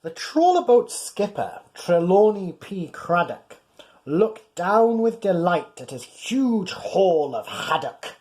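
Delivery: put-on lively read-aloud for a child; bouncy rhyming story voice; storybook narration. A narrator's reading. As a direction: tell it like a bedtime picture book. Reading a rhyming picture book.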